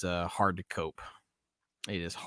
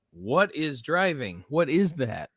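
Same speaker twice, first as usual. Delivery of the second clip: mocking; 6 semitones higher, 4 dB louder, slower than usual. Mocking drivers baffled by rain.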